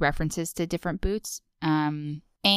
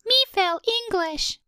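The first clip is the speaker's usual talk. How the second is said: Ralph Wiggum voice; 13 semitones higher than usual. Retold or imitated. Ralph impressions trade.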